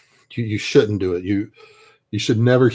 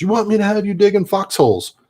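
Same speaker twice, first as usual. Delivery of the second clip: higher, louder angry retort; furious sergeant; 11 semitones higher, 4 dB louder than usual. Retold or imitated. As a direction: throw the words back at the private in anger. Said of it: "he was just so pissed".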